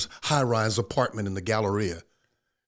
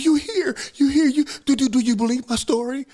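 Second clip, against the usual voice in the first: pleading, frantic; 12 semitones higher, 6 dB louder than usual. Retold or imitated. Wife, crying, begs Rick.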